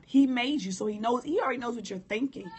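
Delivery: usual speaking voice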